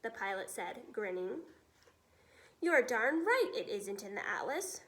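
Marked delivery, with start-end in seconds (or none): none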